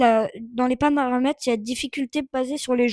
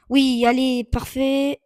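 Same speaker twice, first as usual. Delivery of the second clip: ordinary talk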